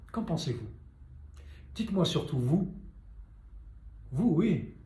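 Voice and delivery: normal talking voice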